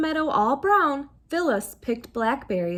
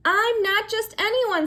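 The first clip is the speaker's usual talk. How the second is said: indignant; 9 semitones higher, 4 dB louder than usual. A narrator's reading. Phyllis asserts herself.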